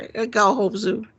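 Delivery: high-pitched imitation of a kid; whiny little kid. Friend quoting a movie kid, high whiny kid voice. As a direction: squeak it like a little weenie. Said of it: "he says it like a kid".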